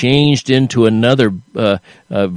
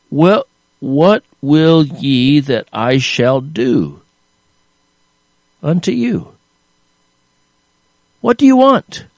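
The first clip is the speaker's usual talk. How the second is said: Jesus asking; 2 semitones higher than usual. Retold or imitated. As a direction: ask them gently then lift it. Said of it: "he asked them a question".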